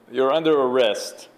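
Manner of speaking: normal talking voice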